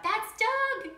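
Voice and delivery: put-on high, eager child's voice; a small girl protecting her pet; little girl voice. A narrator's reading. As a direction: Elizabeth telling her parents about Doug. Child defends her pet bug.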